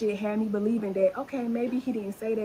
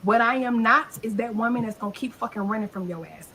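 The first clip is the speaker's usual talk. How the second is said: usual speaking voice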